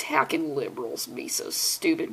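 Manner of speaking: low, soft, dim-witted bigot voice; mock bigot. Vlogger mocks the bigoted townsfolk in a low, dopey voice. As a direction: sneer it like a dumb townsperson.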